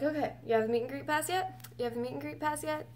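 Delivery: high, soft, hurried mock pestering; eager pestering. Acting their repeated pestering at Will Call.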